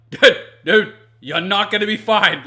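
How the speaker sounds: loud, high, urgent "dude, you're not gonna be fine"; loud pleading warning; exasperated warning shout. Retold or imitated. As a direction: yell at a friend who won't listen. Warning friend, yelling at him.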